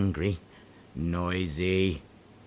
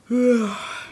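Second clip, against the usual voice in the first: a long, high, weary sigh; 12 semitones higher, 4 dB louder than usual. Acted. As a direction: sigh like you're fed up. Grumpy creature sighs at noise.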